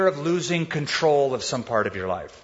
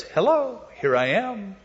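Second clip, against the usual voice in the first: teasing thought voice; 5 semitones higher than usual. Retold or imitated. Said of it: "it says".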